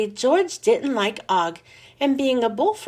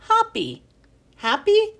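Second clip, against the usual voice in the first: explaining a pun; 9 semitones higher than usual. A narrator's reading. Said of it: "he tried to explain".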